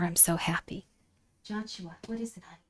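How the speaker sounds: soft unvoiced happy whisper; ASMR whisper. Telling viewers about the merch, ASMR whisper. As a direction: whisper it close, ASMR style. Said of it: "I'm becoming A S M R".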